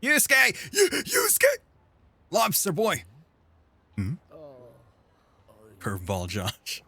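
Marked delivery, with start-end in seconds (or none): 0.0-3.0 high, loud fan squeal; gleeful high yelling; excited cry. Real, not acted. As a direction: shout the name in high-pitched excitement. Excited shout at character appearing.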